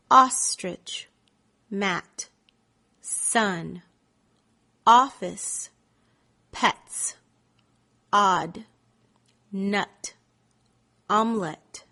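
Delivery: isolated words with long pauses between; one at a time. Shown as a demonstration. Teacher giving words for students to respond to, one at a time with long gaps. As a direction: read out a word list, each word set apart. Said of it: "Say the words one at a time".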